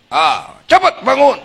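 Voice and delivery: mock angry wake-up yell; harsh hurry-up shout. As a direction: snap at her to get up fast. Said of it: "ada suami bilang ke Mamah Nunung".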